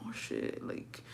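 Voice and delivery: hushed, whispered aside between relatives; imitated furtive whisper; alarmed whisper. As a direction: whisper it under your breath, worried. Uncles warn each other under breath.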